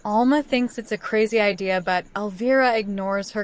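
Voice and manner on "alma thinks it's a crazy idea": plain reading voice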